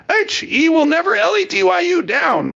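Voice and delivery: very high, odd-voiced rendering of a capitalized slogan; all-caps slogan. Commentator quoting a politician's capitalized endorsement, shrill all-caps voice. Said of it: "The capitalization is the only thing working harder".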